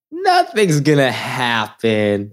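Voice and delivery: slow, stretched-out brush-off; drawn-out dismissal. Retold or imitated. Brushing off a friend's unlocked-car worry, slowly drawn out. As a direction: drag the words out to wave off worry.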